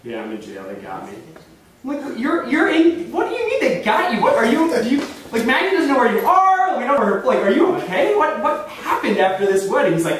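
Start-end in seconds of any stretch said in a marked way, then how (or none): 0.0-1.1 put-on low, subdued drunk voice; wasted man. Drunk friend admitting arrest to a caller, low and wasted. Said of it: "He's clearly, like, wasted". 2.2-9.9 high, stammering barrage of questions; alarmed disbelief. Retold or imitated. Caller demanding answers from jailed friend, alarmed and incredulous.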